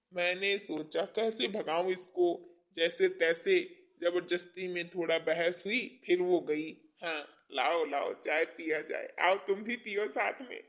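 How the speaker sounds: soft, low flirtatious murmur; sweet-talking.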